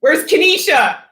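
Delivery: high, loud hyped shout; rowdy call-out. Retold or imitated. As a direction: holler it like you're calling your girl over. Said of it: "I was gonna say".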